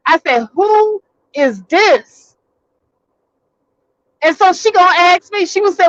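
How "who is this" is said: demanding. Retold or imitated. Demanding the caller's name.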